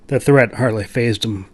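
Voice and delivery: ordinary delivery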